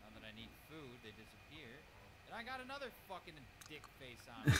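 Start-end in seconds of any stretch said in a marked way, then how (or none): 2.3-4.5 loud angry rant; raging gamer. Real, not acted. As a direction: fume at the new attacker.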